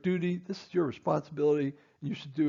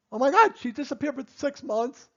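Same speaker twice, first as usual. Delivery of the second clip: higher, louder oh my God of disbelief; mock shock; 9 semitones higher, 5 dB louder than usual. Dentist telling staff about vanished patient, mock shock. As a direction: throw up your hands in surprise.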